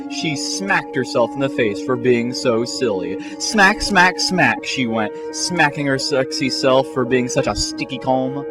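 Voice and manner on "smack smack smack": ordinary delivery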